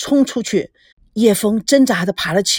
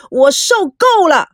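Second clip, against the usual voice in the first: higher, drawn-out cry of anger; angry shout; 6 semitones higher, 4 dB louder, slower than usual. A narrator's reading. Husband erupting at the bad news, angry shout. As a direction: burst out, fed up. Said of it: "大叫说".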